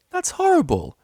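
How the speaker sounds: high and loud dismay; appalled cry; horrified. A narrator's reading. Octavia appalled at Vinyl's parents, high dismay.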